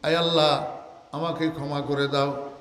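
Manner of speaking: ordinary delivery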